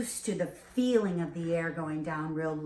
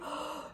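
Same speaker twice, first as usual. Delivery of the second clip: quick shallow gasp into the chest; 11 dB quieter than usual. Shown as a demonstration. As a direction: show a bad shallow breath. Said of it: "they breathe".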